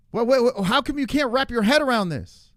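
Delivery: high, loud disbelief; can't believe what he's hearing; exasperated. Real, not acted. Exasperated rant at chatter.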